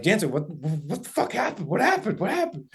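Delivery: high, urgent retold questions; frantic questioning. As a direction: imitate friends rushing in, voice climbing with each repeat. Friends rush up to shaken hunter.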